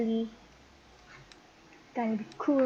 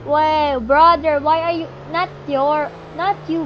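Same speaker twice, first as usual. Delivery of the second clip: loud call to the brother, much louder; 2 semitones higher, 9 dB louder than usual. Real, not acted. Kid shouting to his brother in the game, loud.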